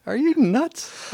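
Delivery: high, slow, drawn-out mock disbelief; incredulous question. Girlfriend staring at him, asking if he's nuts, high and slow. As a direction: stretch it out like you can't believe him.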